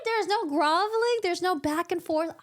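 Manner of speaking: can't believe it's skipped; indignant disbelief. Retold or imitated. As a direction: reader outraged the groveling was skipped, shocked. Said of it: "I'm like".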